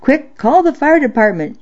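urgent high yell. Acted. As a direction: call out in a hurry. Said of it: "she yelled over her shoulder".

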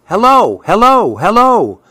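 high, swooping crow calls; cawing crow. A narrator's reading. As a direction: caw it out like a crow, lightly hammed up. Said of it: "A nearby crow caws".

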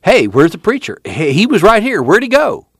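high, urgent calling question; startled, rising search for someone; sudden query. A narrator's reading. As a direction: ask it suddenly, pitch climbing. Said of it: "Suddenly Tom said".

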